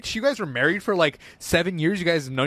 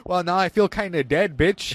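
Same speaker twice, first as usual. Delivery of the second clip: ordinary talk, nothing marked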